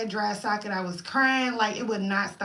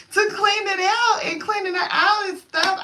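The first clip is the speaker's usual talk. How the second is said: laughing; 9 semitones higher, 5 dB louder than usual. Real, not acted. Laughing through retold dentist words.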